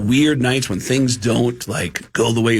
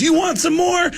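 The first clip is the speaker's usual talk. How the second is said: high, shouted rock-frontman call. Retold or imitated. Rock singer teasing the crowd, high shout. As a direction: whip up the crowd with a high yell. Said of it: "And then [name] goes".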